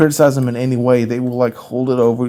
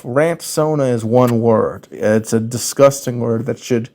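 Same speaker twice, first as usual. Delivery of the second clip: ordinary talk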